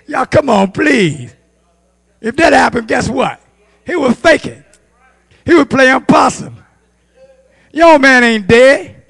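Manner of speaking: loud, high exclaiming preacher; full-force preaching. Real, not acted. Preacher exposing old man playing possum, full-force preaching. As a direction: cry it out to the congregation, loud and high.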